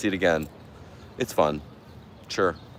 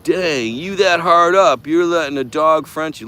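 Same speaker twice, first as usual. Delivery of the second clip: mocking commenter voice; 9 semitones higher, 9 dB louder than usual. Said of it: "He's like".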